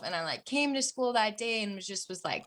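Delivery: ordinary delivery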